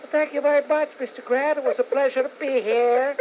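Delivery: high, thin speaking voice of a singer; mock high-pitched talk. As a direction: squeak it out politely. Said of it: "it's something like this".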